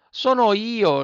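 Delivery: high, loud puppet call; Pinocchio at the door. A narrator's reading. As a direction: call through the closed door. (il burattino chiama alla porta, voce acuta)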